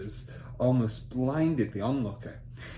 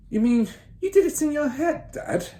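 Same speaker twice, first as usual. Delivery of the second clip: much higher, louder goggling question; amazed son; 7 dB louder than usual. Acted. Son amazed father did sums in his head, high wonder. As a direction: gasp it out in wonder.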